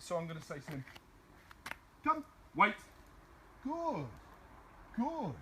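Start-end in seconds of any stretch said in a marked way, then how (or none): 2.0-2.8 loud, high, firm command to a dog; commanding call. Shown as a demonstration. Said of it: "in quite a commanding tone". 3.6-5.3 high praise. Real, not acted. Praising dog after command.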